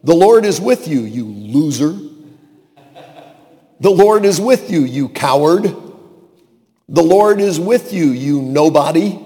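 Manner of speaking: loud, high, jeering proclamation; booming blessing with a put-down tacked on; mock insult from God. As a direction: proclaim it, then sneer the insult. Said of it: "God could have said to him".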